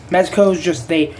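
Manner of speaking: normal talking voice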